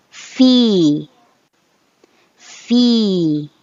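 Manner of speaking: each word with a long held ee; drawn-out ee examples. Shown as a demonstration. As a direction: stretch the ee in every word. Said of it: "prolong the sound at least two seconds".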